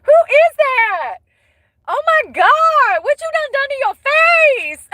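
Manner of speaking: high, slow, shrieking disbelief; horrified high-pitched gasp at a ruined face; shocked exclamation. Retold or imitated. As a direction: squeal it like you can't believe your eyes. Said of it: "I said".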